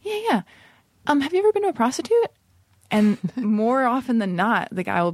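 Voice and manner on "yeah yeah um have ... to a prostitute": breezy, high and offhand; super casual. Retold or imitated. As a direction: toss the loaded question out lightly. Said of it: "like, super casual".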